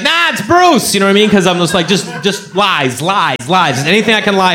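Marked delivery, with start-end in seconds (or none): 0.0-0.9 high squeaky correction; higher mock correction. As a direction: pipe up high, playful.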